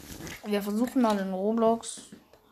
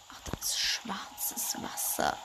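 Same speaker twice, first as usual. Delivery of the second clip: whisper; 9 dB quieter, slower than usual. Real, not acted. Quiet aside while exploring.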